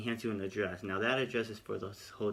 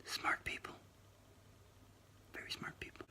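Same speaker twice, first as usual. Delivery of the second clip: whispered dry aside, much softer; sarcastic whisper; 13 dB quieter than usual. Real, not acted. Whispering to viewers about the staff's distrust, sarcastic. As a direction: drop to a whisper to mock them.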